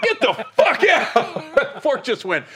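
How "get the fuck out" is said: high, slow, laughing mock yell; hammed-up get-out through laughter; mock angry yell. Throwing the fork at his friend, laughing mock yell.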